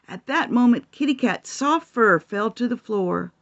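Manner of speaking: plain reading voice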